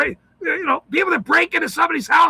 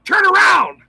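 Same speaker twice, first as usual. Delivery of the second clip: loud, higher cop order; mock police shout; barked command; 5 semitones higher, 5 dB louder than usual. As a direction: yell it like a cop making an arrest. Shouting at a suspect.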